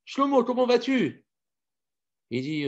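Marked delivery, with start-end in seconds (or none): 0.0-1.2 high, loud friendly hail; cheery friend. Acted. Friend hailing Shlomo in the street, high and cheery. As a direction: call out to him brightly. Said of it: "un copain qui lui dit".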